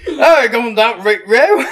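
slow, loud, high nonsense Cockney; Cockney British. Shown as a demonstration. Doing the bit for co-host, exaggerated Cockney British. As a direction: ham up a garbled Cockney shout. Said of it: "you wanna hear Cockney British?".

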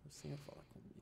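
softly murmured plea; a hushed, urgent prayer under the breath; quiet prayer. Retold or imitated. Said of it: "tava bem baixinho". (oração baixinha, quase sussurrada)

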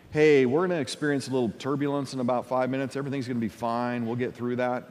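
quick, low, soft cockpit patter; pilot announcement. Acted. Pilot to passengers about turbulence, casual reassurance. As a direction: breeze through it quietly and reassuringly.

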